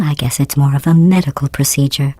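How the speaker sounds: normal talking voice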